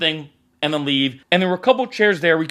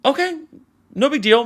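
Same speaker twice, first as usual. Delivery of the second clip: surprised; 9 semitones higher than usual. Retold or imitated. Taken aback by odd warning.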